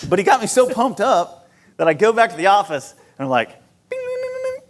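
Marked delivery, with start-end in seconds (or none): 3.9-4.6 high-pitched beeped "bing" noises; imitated phone dialing. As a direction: mimic the phone's dial tones.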